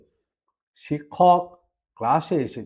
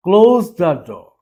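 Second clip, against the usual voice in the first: very slow, louder command; slow and firm; 6 dB louder, much slower than usual. Shown as a demonstration. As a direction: stretch each word so learners can copy. (ধীরে ও জোরে আদেশ)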